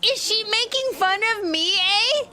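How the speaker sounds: high, hammed-up Canadian accent; exaggerated Canadian "eh" talk, pitched high; mock Canadian accent. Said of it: "With my accent, eh?".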